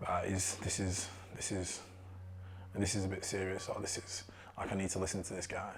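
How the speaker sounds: quiet, halting self-talk; retold sobering realisation, said softly; calming down. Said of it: "I started to come down and think".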